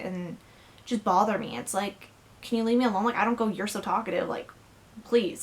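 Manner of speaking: ordinary talk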